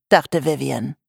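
ordinary delivery

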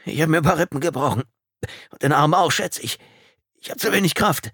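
high, strained, pained voice; hurting and short of strength; groaning in pain. A narrator's reading. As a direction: force the words out through pain. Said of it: "Er stöhnte erneut".

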